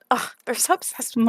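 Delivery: diva hair-flip. Acted. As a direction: toss it off like a vain diva. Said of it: "flips his hair to the side".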